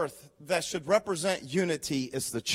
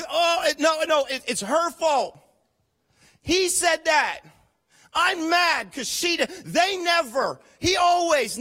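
higher, louder whiny blame; mock blaming complaint; 6 semitones higher, 4 dB louder, slower than usual. Preacher imitating a churchgoer blaming everyone else. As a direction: ham up a petty grudge. Mimics congregants blaming others.

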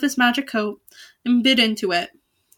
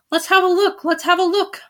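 excited cry; 7 semitones higher, 3 dB louder than usual. A narrator's reading. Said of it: "they cried".

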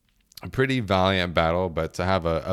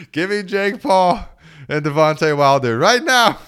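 hype demand; 10 semitones higher, 8 dB louder than usual. Real, not acted. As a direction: shout for the fight you want to see. Hyping a dream fight.

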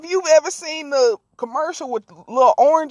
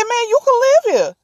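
pleading; 9 semitones higher, 3 dB louder than usual. Retold or imitated. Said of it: "he said".

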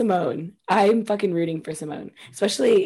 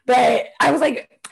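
normal talking voice

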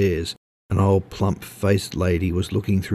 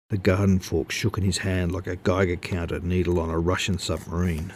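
ordinary delivery, nothing marked

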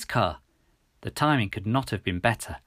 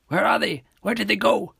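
high, raised policeman's voice; urgent questioning; 9 semitones higher, 4 dB louder than usual. A narrator's reading. Officer Roebuck asking after the gang, urgent questioning. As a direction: demand answers urgently, pitched high.

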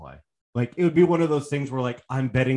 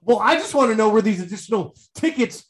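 mock fiery complaint; 9 semitones higher than usual. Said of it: "the boys are fiery right now".

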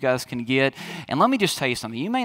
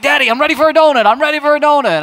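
put-on high, loud, fast excited child voice; eager little boy; 10 semitones higher, 9 dB louder, faster than usual. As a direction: bounce it like a kid waking dad at dawn. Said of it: "He's like".

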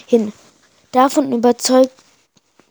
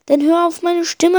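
calling out; 7 semitones higher, 3 dB louder than usual. A narrator's reading. Guiding friend in the dark.

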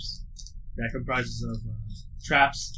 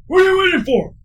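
shouting drill command; 13 dB louder than usual. Acted. Trainer yelling start order.

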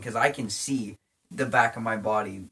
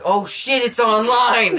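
much higher and louder, panicked "oh shit, it's online"; panicked shout; 12 semitones higher, 12 dB louder, slower than usual. Retold or imitated. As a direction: yell it as the bear won't stop. Retold panic at the bear.

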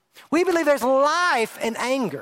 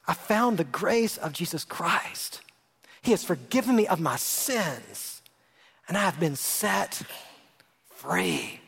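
tender testimony; 7 semitones lower, 6 dB quieter, slower than usual. Real, not acted. Testimony of being set free.

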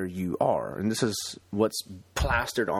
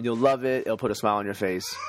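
usual speaking voice

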